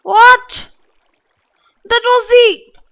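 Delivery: high, loud startled cry of disbelief; real shock at being trolled; shocked outburst. Real, not acted. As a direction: blurt it out, caught off guard. Troll reveal in map.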